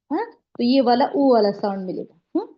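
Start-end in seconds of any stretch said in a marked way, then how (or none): none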